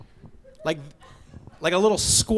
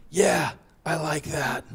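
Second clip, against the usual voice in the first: creepy clerk; 2 semitones lower, 7 dB quieter, slower than usual. Retold or imitated. Creepy sex shop clerk.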